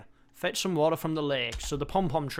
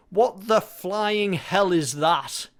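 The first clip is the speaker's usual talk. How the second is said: slow, louder, stretched-out disbelief; incredulous exclamation; 4 semitones higher, 7 dB louder, much slower than usual. Real, not acted. Player meeting a weird creature in the game, in slow, drawn-out disbelief. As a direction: drag each word out in mock horror.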